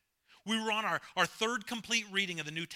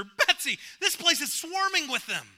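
1 alarmed; 10 semitones higher, 6 dB louder than usual. A narrator's reading. Corrie alarmed about fleas.

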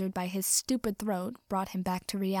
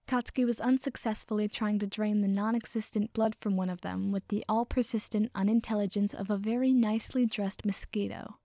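plain reading voice

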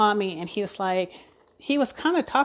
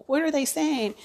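anxious question; 6 semitones higher than usual. Retold or imitated. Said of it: "like, freaking out".

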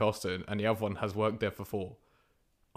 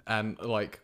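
ordinary talk

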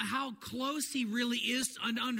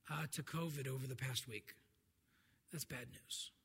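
softer, low and heavy with grief; real sorrow; 8 semitones lower, 11 dB quieter than usual. Real, not acted. Pastor telling the church of a family's covid loss, grieving softly. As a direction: let the loss weigh the words down.

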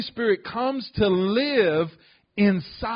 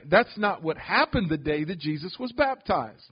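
normal talking voice

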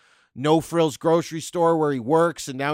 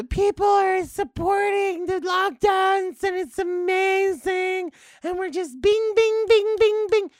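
much higher, slow, shouted sing-song gush; mock gushing neighbour; slower than usual. Comic mocking pot-banging neighbours, high and shouted. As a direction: gush high like a giddy pan-banger, then bing the pots.

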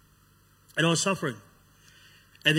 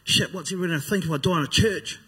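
higher, louder voice of alarmed thought; mild retold panic; 4 semitones higher, 4 dB louder than usual. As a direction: relive a touch of panic. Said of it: "I start panicking 'cause I'm thinking".

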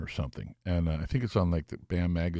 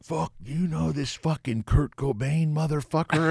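slow, higher rocker voice; 7 semitones higher, slower than usual. Retold or imitated. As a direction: drawl it like the old rocker.

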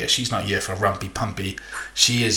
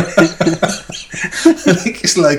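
high, laughing through the words; 7 semitones higher, 6 dB louder, slower than usual. Real, not acted. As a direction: giggle while saying it. Laughing while talking.

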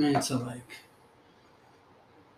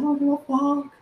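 higher, louder mock outburst; 12 semitones higher, 5 dB louder than usual. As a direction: fling it out, half joking. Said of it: "I was like".

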